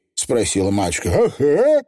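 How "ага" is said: high, sure little Feegle; chirpy pixie agreeing; high cocky Feegle yes; confident Feegle. Acted. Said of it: "уверенно отозвался Фигль".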